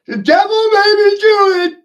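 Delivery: very high, very loud squeal, punching do; mock sinner's wail. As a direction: scream the excuse like a cartoon sinner, hitting do. Mocking sinner's excuse.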